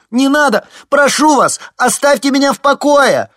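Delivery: very high, very loud scream; shrieking panic; terrified scream. A narrator's reading. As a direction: scream in a waking nightmare. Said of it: "кричал он".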